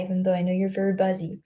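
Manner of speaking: ordinary talk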